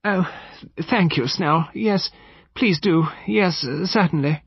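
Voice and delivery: high, flustered, relieved lady; hesitant, relieved mistress's voice; manifest relief. A narrator's reading. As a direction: stammer thanks in high-pitched relief. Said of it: "seized on the suggestion with manifest relief".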